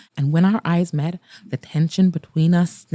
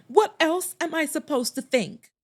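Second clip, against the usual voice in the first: higher, pushing demand; challenging, demanding question; 9 semitones higher, 4 dB quieter than usual. Acted. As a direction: insist on an answer. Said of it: "I demanded".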